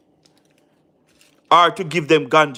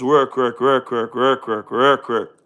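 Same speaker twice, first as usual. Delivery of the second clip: low, droning run of repeated work; weary repetition; 5 semitones lower, slower than usual. Real, not acted. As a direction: roll the word over and over, sinking lower. Listing the grind of long workday.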